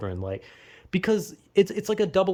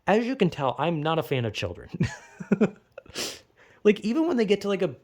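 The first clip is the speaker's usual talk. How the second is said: usual speaking voice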